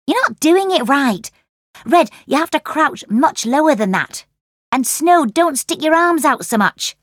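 bossy correcting. Acted. Correcting friends' ninja moves.

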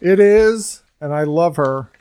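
ordinary talk, nothing marked

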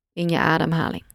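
normal talking voice

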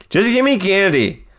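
put-on high, pleading kid voice; whiny kid self. Kid in costume begging for candy, high and whiny.

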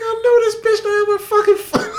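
laughing outrage at the foot between seats; laughing shout. Retold or imitated. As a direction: blurt it out in laughing disbelief. Incredulous retelling, laughing shout.

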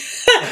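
short high-pitched giggle; high laugh. Real, not acted. Laughing at impression.

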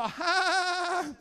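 loud, high, drawn-out fake guffaw; mock a big seventies crowd laugh; imitated audience laugh. Showing how people laughed at the catchphrase, exaggerated laughter. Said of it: "people would laugh just like that".

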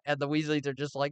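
normal talking voice

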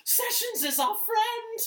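very high, loud, slow creature whine; Smeagol voice. Retold or imitated. McConnell defending Sessions, squealing Gollum voice. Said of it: "sound like Smeagol".

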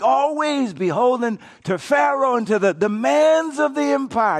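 fervent preaching. Acted. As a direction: rail against the empire. Preaching at full force.

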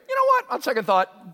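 jumps high and loud; spring the thought on him; sudden afterthought. Acted. David stopping Abishai, a sudden high afterthought.

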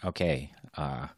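usual speaking voice

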